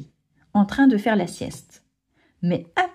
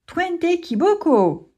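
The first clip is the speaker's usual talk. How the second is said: encouraging cheer; 9 semitones higher, slower than usual. Acted. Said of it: "les encourage l'hippopotame".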